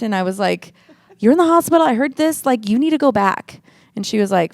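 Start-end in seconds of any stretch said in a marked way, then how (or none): 1.2-3.6 pushing her sister to go back; urgent plea. Retold or imitated. Sister urging sister to return, high and insistent.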